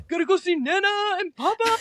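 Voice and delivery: the pup can't wait to leave; dog's voice. Pretend. Hanzo heading to visit Nana and Papa, high excited dog voice. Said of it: "share what Hanzo's voice is".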